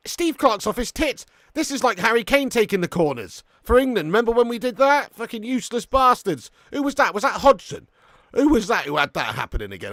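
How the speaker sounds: high, loud football-fan outrage; exasperated rant. Real, not acted. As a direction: rant at a useless manager. Ranting at manager over corner taker.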